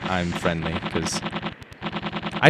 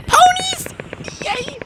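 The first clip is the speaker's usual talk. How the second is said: excited squeal; slower than usual. Real, not acted. Squeals at ponies in game.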